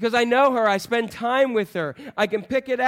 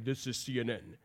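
much lower, softer booming announcer voice; James Earl Jones impression; 8 semitones lower, 11 dB quieter than usual. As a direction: drop deep and quiet like a famous voice saying the network name. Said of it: "like it's like James Earl Jones saying".

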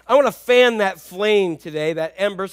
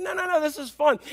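delighted Jesus, high; 7 semitones higher than usual. Retold or imitated. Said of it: "He's like".

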